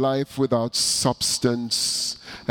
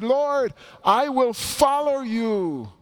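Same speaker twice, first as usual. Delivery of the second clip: much higher, slow, even more eager plea from a second follower; another eager voice; 10 semitones higher, slower than usual. Acted. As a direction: pitch the second voice up high, pleading and keen. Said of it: "another voice that speaks up".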